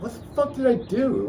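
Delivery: high-pitched mock indignation; protesting. Son protests mom's blame, high mock-indignant voice. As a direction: squeak out a baffled protest, pitch way up. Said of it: "I'm like".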